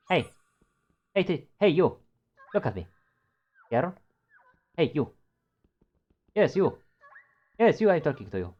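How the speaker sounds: high loud calling. Acted. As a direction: call out to get attention. Calling to creature.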